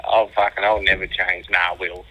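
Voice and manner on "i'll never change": ordinary delivery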